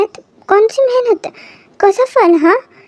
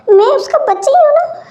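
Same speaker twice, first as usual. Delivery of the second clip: mock whimper; 7 semitones higher than usual. Husband whines like a child.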